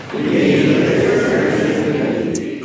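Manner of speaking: loud, slow, drawn-out response; the whole room answering back; congregation with conviction. Real, not acted. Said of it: "I think you believe that".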